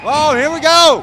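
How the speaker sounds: loud, high "here we go"; excited. Real, not acted. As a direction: shout it as chaos starts. Brawl breaking out after match.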